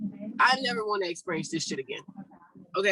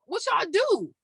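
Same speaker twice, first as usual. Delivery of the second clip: alarmed question; 10 semitones higher, 3 dB louder, slower than usual. Retold or imitated. Hoarse throat after surgery.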